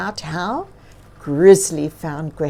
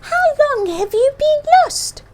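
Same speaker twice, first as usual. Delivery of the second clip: high, concerned bird voice; wondering bird; 4 dB louder than usual. A narrator's reading. Bird asking the bear how long he's lost, high and wondering. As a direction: ask it high like a worried little bird. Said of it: "Bird wondered".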